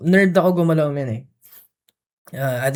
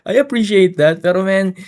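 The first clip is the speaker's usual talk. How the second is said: laughing it off, pitched up and louder; 4 semitones higher, 5 dB louder than usual. Real, not acted. Shrugging off people's advice to relax, laughing. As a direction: chuckle through the words.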